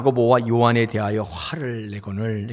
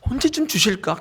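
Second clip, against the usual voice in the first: a lightly voiced eager question, pitched higher; impatient disciple; 8 semitones higher than usual. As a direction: wonder aloud like an impatient follower, lightly played. Disciples waiting for a reward.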